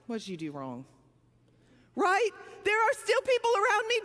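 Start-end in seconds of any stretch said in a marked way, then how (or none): 0.1-0.9 much lower and softer accusing question; judging sneer. Retold or imitated. Onlookers judging a sufferer, low soft sneer. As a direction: voice the judges looking down on you.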